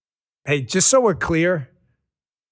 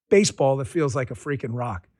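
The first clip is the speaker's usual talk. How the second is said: ordinary talk, nothing marked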